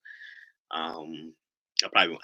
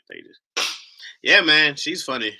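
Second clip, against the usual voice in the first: ordinary talk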